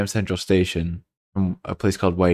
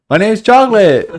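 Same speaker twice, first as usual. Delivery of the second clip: much higher and louder, hammed-up cartoon voice; mock character voice; 13 semitones higher, 10 dB louder than usual. Friend voicing a movie character named Chocolate, hammed-up and high. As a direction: squeak it like a silly cartoon character.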